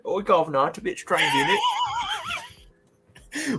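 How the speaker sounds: hammed-up British accent, high and light, with a mock "governor"; a silly fake British voice played for laughs. Teasing the co-host in a hammed-up British accent. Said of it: "put on a British accent".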